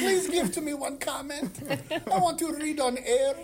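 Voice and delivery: high, loud whiny pleading; hammed-up groveling. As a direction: beg shamelessly in broken English. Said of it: "I sound like I'm begging".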